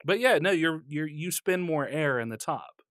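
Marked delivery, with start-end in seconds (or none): none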